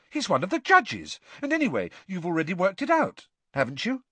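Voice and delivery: high, indignant protest; shocked. A narrator's reading. Hermione objects to Bagman judging and helping, high and shocked. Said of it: "looking very shocked".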